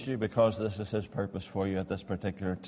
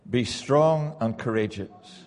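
slow weighty reading; 4 dB louder, slower than usual. Acted.

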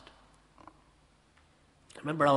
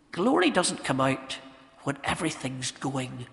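ordinary talk

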